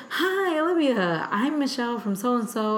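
high caller voice, easing down; Michelle. Retold or imitated. Web team caller introducing herself, high funny voice. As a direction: imitate a funny phone greeting. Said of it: "like, a funny voice".

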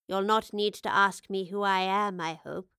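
a lightly voiced old professor, kindly; a hint of an old woman smiling at her younger self; elderly professor. Elderly professor speaks gently.

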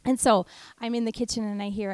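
ordinary delivery

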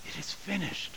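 hushed repeat. Shown as a demonstration. Pastor repeating Jesus' words, reverent hush. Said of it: "the words are the same".

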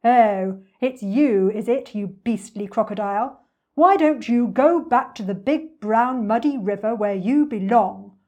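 loud, booming indignation; forceful elephant scolding; big-voiced elephant. A narrator's reading. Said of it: "in his big, deep voice".

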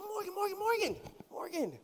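soft, high-pitched coaxing; hushed coaxing dad. Retold or imitated. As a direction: try to hush a panicking child. Father trying to calm clinging daughter.

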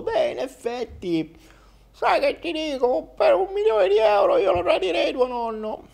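the wolf dressed up as grandma; big bad wolf granny. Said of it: "con la voce della nonna sembro il lupo".

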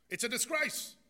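loud, high, fast indignant rant; outraged patient. Acted. Offended patient rails at his doctor, loud indignant outrage. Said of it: "he says".

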